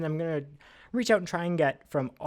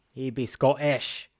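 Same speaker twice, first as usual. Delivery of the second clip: slow, badly faked Scottish accent; terrible Scottish accent; slower than usual. Trivia host retrying the answer, hammed-up bad Scottish accent. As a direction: ham up a poor Scottish accent slowly. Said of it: "that's a terrible Scottish accent".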